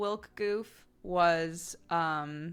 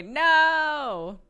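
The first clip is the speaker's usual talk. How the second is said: loud, much higher anguished no; real outcry; 10 semitones higher, 11 dB louder than usual. Real, not acted. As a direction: cry out in real dismay. Reacting to server maintenance news.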